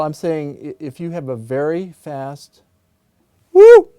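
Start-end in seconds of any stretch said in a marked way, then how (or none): 3.5-3.9 very high, very loud whoop to ring the strings; high loud woo. Shown as a demonstration. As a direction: whoop up high to set things vibrating. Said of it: "You can hear these ... resonating".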